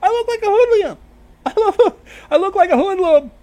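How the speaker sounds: high, loud, giddy boast; gleeful hoodlum. Acted. Vinyl shows off her hoodlum look, high and giddy.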